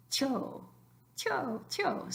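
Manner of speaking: TÍO squashed to "tyo"; one-syllable tío. Shown as a demonstration. Teacher trying it for students, tío as one-syllable "tyo". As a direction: glide quickly from I into a stretched O in tío, one beat. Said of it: "tío in one syllable".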